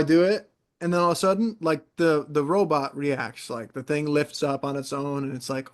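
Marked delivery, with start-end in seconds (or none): none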